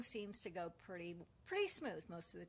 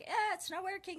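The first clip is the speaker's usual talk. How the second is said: whiny complaint; 11 semitones higher, 8 dB louder than usual. Retold or imitated. Complaining to tech support.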